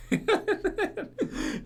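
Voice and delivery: a long, high giggle that rises at the end; giggling helplessly; high laugh. Real, not acted. Vlogger laughing at a dubbed Walking Dead quote.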